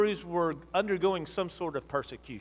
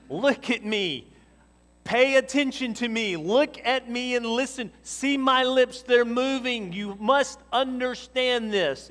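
high, loud, slow exasperated parent lecture; hammed-up parent holding a child's face; over-enunciated mock parent scolding; 8 semitones higher, 7 dB louder, slower than usual. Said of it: "If a parent ever takes a child and grabs the side of their faces".

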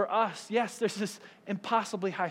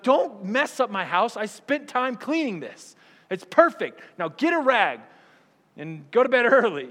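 angry parent; 5 semitones higher, 7 dB louder than usual. Retold or imitated. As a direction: scold the kid for spilling milk. Strict parent scolding child over milk.

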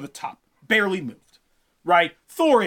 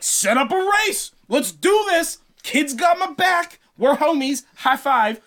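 psyched bro ready to race; hyped Thor; 7 semitones higher than usual. Acted. Thor eager to race the giants, pumped and high.

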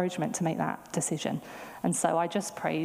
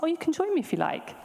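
friendly invitation; 10 semitones higher than usual. Retold or imitated. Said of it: "she was like".

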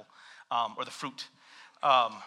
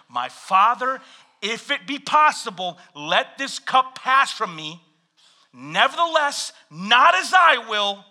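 earnest plea; 6 semitones higher, 6 dB louder, slower than usual. Acted. Jesus praying in Gethsemane reading.

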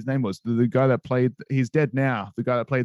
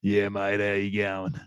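put-on lower, slow broad Australian accent; 4 semitones lower, 4 dB quieter than usual. Mimicking Kano to a friend, in a lower broad Australian accent. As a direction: lay on the Aussie accent, easygoing. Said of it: "uber Australian".

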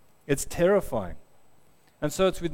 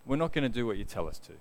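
softer, lower, calm defiance; quiet refusal; 4 semitones lower, 6 dB quieter, faster than usual. Retold or imitated. Three men answering the king, quietly firm. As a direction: say it quietly and quickly, without mocking. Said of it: "but they quietly say".

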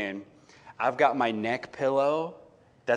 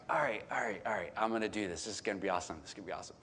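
much softer, hushed pep talk to yourself; softer and softer self-encouragement, fading out; quiet self-talk; 4 semitones lower, 11 dB quieter, much faster than usual. Retold or imitated. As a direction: murmur it as if trying to fall asleep. Psyching himself up to sleep.

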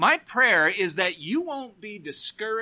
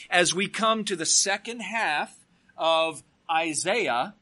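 usual speaking voice

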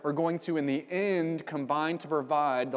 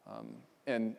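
normal talking voice

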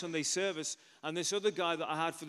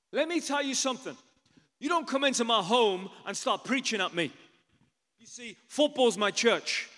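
loud, raised-pitch telling-off; indignant rebuke; 6 semitones higher, 8 dB louder than usual. Retold or imitated. Soccer hooligan rebuking the preacher in his home, indignant and loud. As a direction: lay down the law, loud and forceful.

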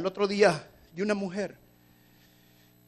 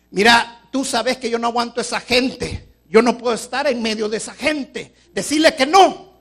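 loud scornful refusal with a laugh; mother snubbing the pastor's call; dismissive mother; 5 semitones higher, 11 dB louder than usual. Acted. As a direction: brush off the request haughtily.

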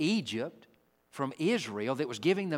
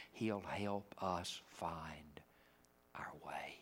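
soft drop; 8 semitones lower, 12 dB quieter, much slower than usual. Real, not acted. Preacher drops to soft close.

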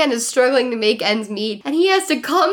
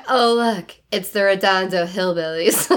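mean girl impression; 5 semitones lower than usual. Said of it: "you were doing a great impression of this character".

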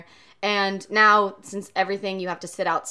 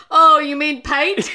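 loud, drawn-out mock Aussie accent; Australian accent; 4 semitones higher, 7 dB louder, slower than usual. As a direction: tease with an exaggerated Australian "Pate" for Pete. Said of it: "That's a good Aussie accent".

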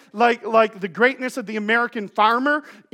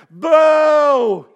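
loud mocking boo from the family; shouted family heckle; booing crowd; 3 semitones higher, 7 dB louder, much slower than usual. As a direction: jeer it like a heckler. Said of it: "Everybody went".

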